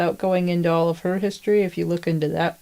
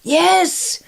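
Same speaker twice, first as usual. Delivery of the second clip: high, loud delighted yes; thrilled shout of recognition; excited yes; 11 semitones higher, 5 dB louder than usual. Real, not acted.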